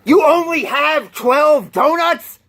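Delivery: high, slow outraged robber; indignant disbelief. Retold or imitated. Robber stunned by the small donut stock, incredulous. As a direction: gasp at the twelve donuts, appalled. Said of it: "he's like".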